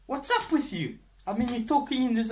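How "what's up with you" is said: sudden high, loud protest; outraged outburst. Acted. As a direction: blow up at your cousin. Cousin angrily bursts out at doctor.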